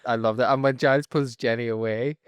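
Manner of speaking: ordinary talk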